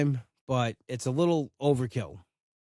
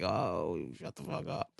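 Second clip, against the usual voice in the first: whispered; 8 semitones lower, 11 dB quieter, slower than usual. Retold or imitated. Exasperated whispered retort.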